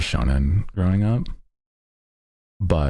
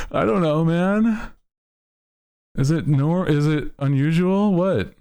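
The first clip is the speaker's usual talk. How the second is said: incredulous viewer voice; 14 semitones higher than usual. Retold or imitated. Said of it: "people were like".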